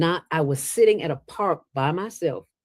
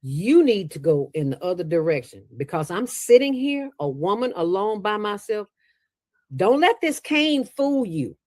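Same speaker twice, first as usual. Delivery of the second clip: higher, firm warning to back off; a mild stern warning, a bit higher; 6 semitones higher than usual. Retold or imitated. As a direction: put him on notice, woman to man. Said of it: "I said".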